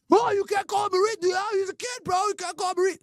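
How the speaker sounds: high, prissy parody of someone scolding; mock offended critic.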